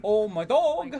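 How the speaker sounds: high, loud Kiwi exclamation; impression of Steve. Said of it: "do an impression of Steve".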